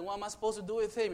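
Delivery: fast, exasperated question; exasperated Pilate. Retold or imitated. Pilate pleading with the crowd, fast and exasperated. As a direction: rush it like a cornered governor. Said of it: "Pontius Pilate was saying".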